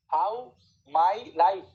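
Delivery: How and life punched loud; stress on How and life. Shown as a demonstration. Teacher marks stress for students, punching How. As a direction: hit How and life, keep my light. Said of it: "মাইটা হচ্ছে adjective".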